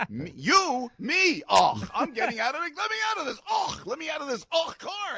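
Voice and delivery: very high-pitched, hammed-up exasperation; mock outburst. Passenger demanding out of the car, mock outburst. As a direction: squeal in mock outrage to be let out. Imagined outburst at driver, then denied.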